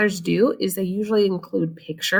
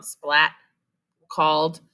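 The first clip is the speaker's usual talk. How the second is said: ordinary delivery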